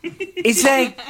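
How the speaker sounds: high-pitched whiny imitation of a complainer; exaggerated high voice, drawn out in places; mock complaining. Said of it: "he was the one complaining about how cold it was".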